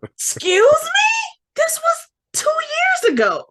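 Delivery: very high, drawn-out squeal of disbelief; astonished. Retold or imitated. Fan amazed the actor remembers her after two years, squealing disbelief. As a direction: shriek it, stunned to be remembered.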